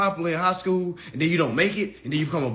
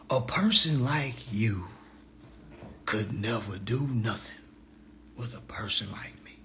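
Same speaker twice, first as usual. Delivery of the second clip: whispered taunt; 8 semitones lower, 7 dB quieter than usual. Real, not acted.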